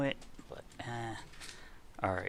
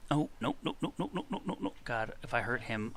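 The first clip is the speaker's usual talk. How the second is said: ordinary talk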